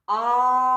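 loud long А opening Автобус; stretched first sound. Shown as a demonstration. Teacher reading with the class, stretching the first А. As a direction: lean hard on the А of Автобус. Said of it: "давайте прочитаємо разом".

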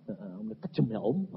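usual speaking voice

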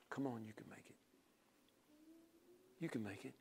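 the Comforter quietly lifting up someone who wants to quit; Holy Ghost encouraging. Retold or imitated. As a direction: coax gently, very softly. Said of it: "He comes and says".

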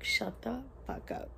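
stunned whisper. Retold or imitated. Retelling disbelief at news.

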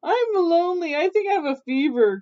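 high-pitched sickbed call. A narrator's reading. Sick mother witch wants company, calling out high. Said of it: "called Mother Witch".

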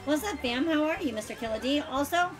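usual speaking voice

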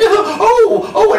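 high squealing oh; a hammed-up thrilled gasp; delighted gasp. Wife sees the sack of stars, high squeal.

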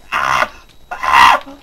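deep grunting yells. Real, not acted. Attacked in game.

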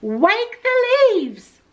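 high, slow drawn-out command; stronger voice. A narrator's reading. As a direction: call it out high, stretching every word. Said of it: "Wadney's voice was stronger".

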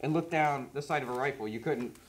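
muffled behind full face mask; speaking inside respirator; talking through gas mask. Real, not acted. Seen 4: Both men wear full gas masks; the speaker is unclear.

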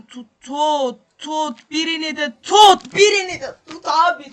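loud, high, urgent shouting; angry commands to his players; frustrated yelling. Real, not acted. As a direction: scream at his defenders to grab someone. Yelling at defenders to tackle.

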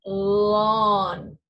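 "Lawn" said loud and slow; modeling lawn. Shown as a demonstration. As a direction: stretch the "aw" in "Lawn". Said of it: "Say that with me, please".

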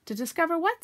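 anxious. A narrator's reading. Piglet questions Pooh about the discovery, anxiously. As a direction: ask as if dreading the answer. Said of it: "said Piglet anxiously".

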